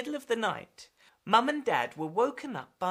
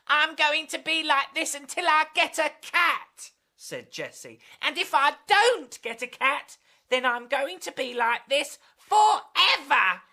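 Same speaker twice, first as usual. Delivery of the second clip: put-on higher, louder insistent girl; cat girl; 7 semitones higher, 5 dB louder than usual. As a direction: demand it like a stubborn kitten.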